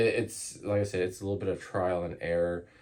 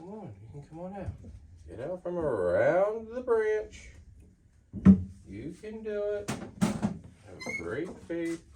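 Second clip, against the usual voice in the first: high, soft coaxing; coaxing pet voice; 8 semitones higher, 5 dB quieter than usual. Real, not acted. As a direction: sweet-talk a shy animal out. Coaxing a snake out of cage.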